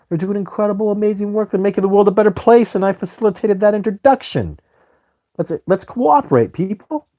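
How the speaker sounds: high, loud rallying pitch; rally the crowd to work together; preaching cooperation to his viewers; fired-up enthusiasm. Real, not acted.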